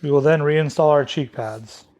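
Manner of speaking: usual speaking voice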